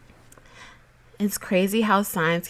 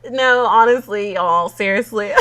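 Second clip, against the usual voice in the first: excited; 4 semitones higher, 7 dB louder than usual. Real, not acted. Emotional laughing outro.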